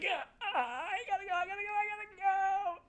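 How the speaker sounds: put-on high, crying kid's whine; desperate whine. Kindergartner wailing at the teacher, high and tearful. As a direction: whimper like a child about to burst. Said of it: "I was like".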